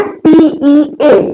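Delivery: slow letter-by-letter spelling; name each letter separately, drawn out; spelled out slowly. Shown as a demonstration. Teacher spelling a word for learners, slowly letter by letter.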